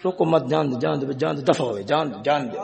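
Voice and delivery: soft, low, offhand wave-away; hushed, resigned permission to pass; dismissive letting go. Acted. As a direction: toss it off quietly, not caring. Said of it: "دوسرا معنی کیا کر رہا ہے".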